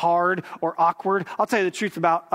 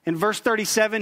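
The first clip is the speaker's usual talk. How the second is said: normal talking voice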